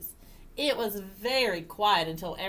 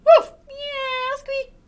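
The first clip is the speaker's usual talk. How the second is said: high imitated woof, meow, squeak; yip, mew and squeak in turn; celebrating pet noises; animal cheers; 10 dB louder, slower than usual.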